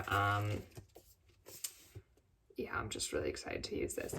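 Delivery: hushed, breathy whisper. Real, not acted. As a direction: whisper it like a secret. Excited aside to camera.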